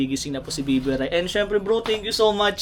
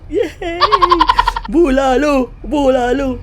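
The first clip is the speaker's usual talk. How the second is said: loud happy food cheer; excited cheer; 8 semitones higher, 6 dB louder, much slower than usual. Real, not acted. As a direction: cheer it out gleefully. Cheering for the soup.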